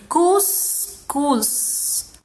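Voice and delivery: CUSCUZ in two beats, cus, then cuz; split into syllables. Shown as a demonstration. Teacher counting two syllables, cuscuz said in two pieces. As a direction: pause in the middle of cuscuz.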